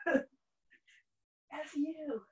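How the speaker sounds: laughing. Pretend. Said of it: "abs be like".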